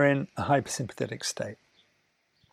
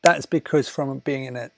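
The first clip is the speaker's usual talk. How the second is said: ordinary delivery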